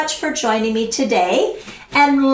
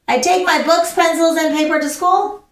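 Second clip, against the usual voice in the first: higher, rushed straight through books, pencils, and paper; run together without pauses; 3 semitones higher, faster than usual. Shown as a demonstration. Teacher showing the class the wrong way, running the list together. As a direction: reel off the whole list in one breath.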